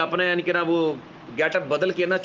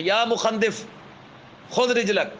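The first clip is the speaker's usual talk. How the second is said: mildly raised mocking cry یا مخندف; raised Arabic taunt; 5 semitones higher, much slower than usual. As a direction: call خذ رجلک out higher in scorn. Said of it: "کہتا ہے".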